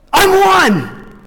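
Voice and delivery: high, loud joyful exclaim; proud reveal. Retold or imitated. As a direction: burst out the news. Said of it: "a guy came running up to him".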